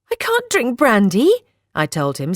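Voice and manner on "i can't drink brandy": high-pitched, rising protest. A narrator's reading. Telling him she cannot drink, spluttered protest. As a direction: object with pitch jumping up. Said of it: "still coughing".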